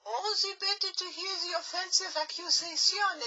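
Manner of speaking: fake granny voice. Wolf posing as granny.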